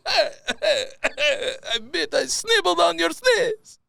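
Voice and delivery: high, slow, cackling taunt; mocking laugh. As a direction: cackle like a smug jerk. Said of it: "laughing in the corner".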